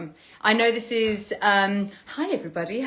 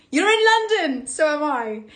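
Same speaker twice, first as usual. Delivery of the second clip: excited surprise; 6 semitones higher, 5 dB louder than usual. Real, not acted. Excited at shared city.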